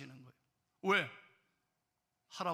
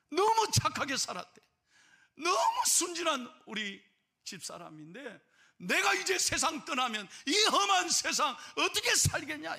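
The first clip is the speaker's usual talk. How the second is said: higher and louder, slowed pleading; anguished plea; 8 semitones higher, 5 dB louder than usual. Retold or imitated. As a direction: cry out your worry for her. Grandfather pleading for his wife.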